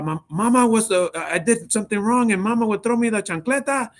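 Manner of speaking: high, hammed-up Mexican accent; Rob's Mexican accent. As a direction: imitate a comic Mexican character reminiscing. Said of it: "he had the full on accent".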